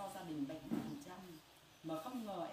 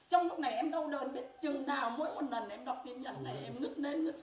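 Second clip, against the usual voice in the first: anguished cry; 6 semitones higher, 6 dB louder than usual. Real, not acted. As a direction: wail about the pain of losing her son. Mother describing grief over son.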